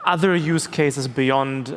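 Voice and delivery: ordinary delivery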